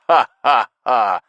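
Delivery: loud, slow ha ha ha; loud laugh. A narrator's reading. Stinky roaring with laughter at his own joke. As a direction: bellow a big laugh.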